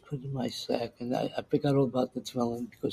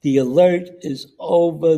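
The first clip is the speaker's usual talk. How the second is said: loud, higher public address call; army loudspeaker ending the alert, loud; loudspeaker announcement; 5 semitones higher, 10 dB louder than usual. Retold or imitated. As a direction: boom it out like a camp loudspeaker. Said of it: "loudspeaker voice comes on and says".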